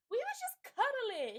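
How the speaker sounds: high, loud and slow mock-innocent whine. Host mocking the daughter's excuse to Miss Lisa, high sarcastic whine. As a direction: drag out the lame excuse to ridicule it.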